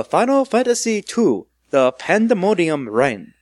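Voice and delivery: slow, high mock Japanese accent; hammy Japanese movie announcer. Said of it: "You have to say them with a Japanese accent".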